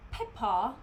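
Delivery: mock British "Peppa" with an open final "ah"; "Peppa" in a put-on British accent, end lengthened and rising. Shown as a demonstration. Teasing the coworker who called them Peppa Pig, mock British accent. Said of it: "my British accent is not good either".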